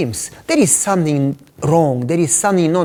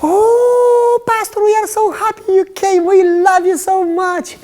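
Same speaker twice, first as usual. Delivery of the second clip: very high, loud mock gushing voice at the door; high-pitched greeting; 5 dB louder than usual. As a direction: mimic her sugary falsetto welcome. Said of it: "spoke with a high-pitched voice".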